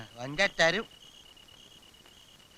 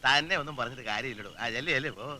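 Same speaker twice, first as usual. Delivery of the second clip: weary dismissal; 11 semitones lower, 9 dB quieter than usual. Acted. Gives up, dismisses brother-in-law.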